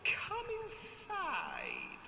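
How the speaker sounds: put-on high sugary old witch; sweet witch voice. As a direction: lure them in like a witch. Witch coaxing children.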